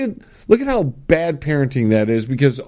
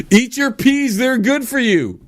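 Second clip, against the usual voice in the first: nagging parent; 9 semitones higher than usual. Retold or imitated. Voicing a parent.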